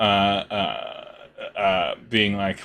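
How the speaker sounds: ordinary delivery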